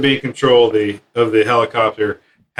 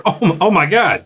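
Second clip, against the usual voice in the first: double take; 7 semitones higher than usual. Retold or imitated. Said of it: "do that double take over your shoulder".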